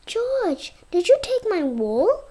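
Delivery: a neighbour raising a surprised question. A narrator's reading. Neighbour confronts George.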